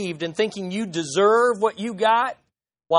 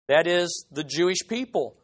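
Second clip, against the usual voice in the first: ordinary talk, nothing marked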